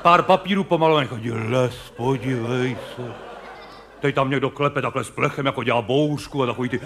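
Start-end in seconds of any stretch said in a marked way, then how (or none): 1.3-3.1 slow, low, awed whisper of wonder; hushed awe at the stage forest; mock wonder. Audience member marvels at forest.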